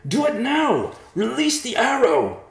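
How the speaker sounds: high, loud urgent shout; crying out. A narrator's reading. Riverpaw urges Thomas to release the arrow, crying out. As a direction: scream the order desperately. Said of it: "Riverpaw cried".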